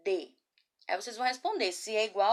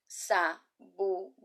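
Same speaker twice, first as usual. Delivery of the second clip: Sabugosa in four slow, separate syllables; much slower than usual. Shown as a demonstration. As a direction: break Sabugosa into sa, bu, go, sa. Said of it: "Eu vou soletrar em seguida".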